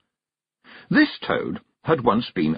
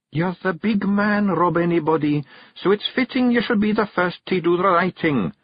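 a clever wife flattering her husband; coaxing Kelda; 10 semitones higher than usual. Acted. Jeanie's voice.

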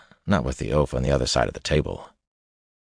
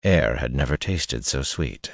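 ordinary delivery, nothing marked

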